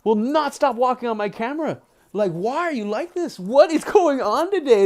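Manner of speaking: high, exasperated complaint about the dog; real fed-up rant. Real, not acted. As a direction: scold in disbelief, pitch up.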